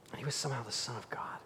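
hushed whisper of awe; a whisper, fast and hushed; awed whisper. Real, not acted. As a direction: breathe it out in wonder.

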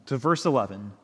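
normal talking voice

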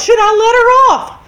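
loud, high, mock-shouted appeal; retold yelled question; calling out a question. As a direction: imitate a girl calling to the adults. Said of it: "She asked the adults".

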